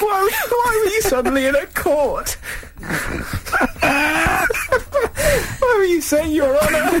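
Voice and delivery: very high, squealing hysterics; helpless laughter. Real, not acted. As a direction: squeal the question while losing it laughing. Hysterics over the court slip.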